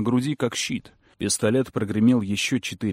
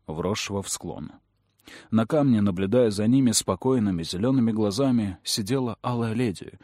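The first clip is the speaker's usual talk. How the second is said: usual speaking voice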